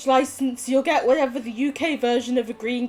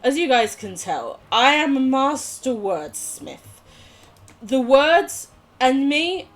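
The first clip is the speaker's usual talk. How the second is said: ordinary talk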